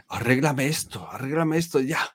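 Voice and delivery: rising, insistent mock client demand; impatient client. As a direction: push harder on the second time. Imitating a demanding cheap client.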